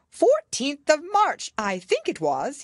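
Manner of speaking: high, loud, slow Hatter; drawn-out uncertain date. A narrator's reading. Hatter answers.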